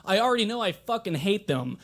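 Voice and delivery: normal talking voice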